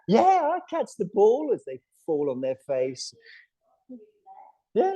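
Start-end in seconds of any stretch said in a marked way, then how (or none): 0.1-1.5 much higher, bright-eyed chirpy dog; cheerful dog voice. Acted. Teacher voices a cheerful dog for the class, much higher. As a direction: play the happy old dog catching the ball. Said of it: "they're still like".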